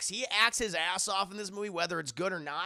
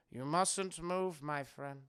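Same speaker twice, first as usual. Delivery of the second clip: slow, soft, low warning; quietly creepy friendly caution; sinister reassurance; 4 semitones lower, 7 dB quieter, slower than usual. Retold or imitated. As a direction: drop to a hushed, measured menace. Same warning repeated softly.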